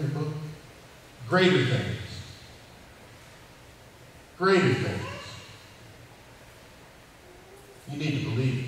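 earnest sermon voice rising and falling; preacher telling a Bible story to his congregation; preaching. Acted. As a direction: preach it to the arena crowd.

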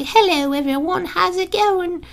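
put-on very high British kid voice; British squeaker. Shown as a demonstration. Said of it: "a little British squeak".

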